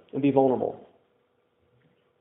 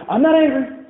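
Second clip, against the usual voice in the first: higher, firm "not" in "I'm not angry"; insistent denial; 7 semitones higher than usual. Retold or imitated. Diplomat husband insisting to his wife, firm denial. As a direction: protest a bit too much. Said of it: "Diplomats like me".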